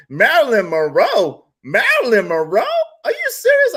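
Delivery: disbelieving Marilyn Monroe, voice shooting up; can't believe what he just heard; incredulous. Retold or imitated. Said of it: "I was like".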